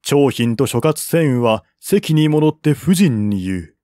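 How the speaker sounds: usual speaking voice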